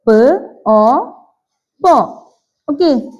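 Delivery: usual speaking voice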